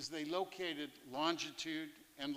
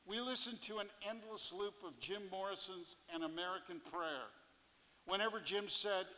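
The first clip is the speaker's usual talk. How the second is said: plain reading voice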